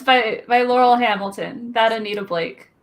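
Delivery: ordinary delivery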